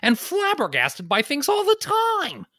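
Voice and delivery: high, chirpy nerdy guy greeting; squeaky cheerful dude intro; goofy Neil voice. Pretend. Continuing the Neil character.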